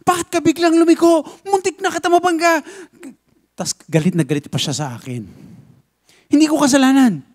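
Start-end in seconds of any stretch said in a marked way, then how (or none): 0.1-2.6 retold shouting. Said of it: "I was shouting at the top of my lungs". 6.3-7.3 angry denial. Retold or imitated. Other driver angrily denying blame.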